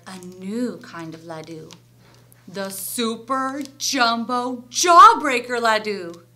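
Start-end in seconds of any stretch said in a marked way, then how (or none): 2.5-6.2 loud, slow big reveal; grand announcement. A narrator's reading. Storyteller unveils the new kind of laddu, loud and slow. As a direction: announce it slowly as something enormous.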